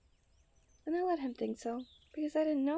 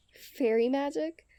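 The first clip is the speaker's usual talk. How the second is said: mocking scoff; 3 semitones higher, 8 dB louder, slower than usual. Amused scoff after laughing.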